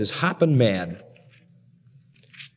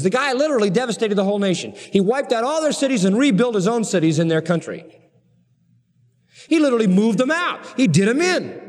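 raised, driving sermon voice; fiery preaching; 4 semitones higher than usual. Acted. Preacher stirring up his congregation about King Uzziah, fiery. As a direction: build the story of the king with mounting force.

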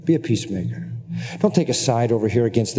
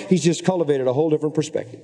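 normal talking voice

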